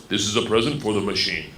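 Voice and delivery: low, reverent Russian official; solemn conductor. Acted. Train conductor honouring a student guest, low and solemn. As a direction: pay homage in a low, grave voice. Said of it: "The conductor walked in".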